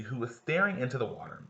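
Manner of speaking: normal talking voice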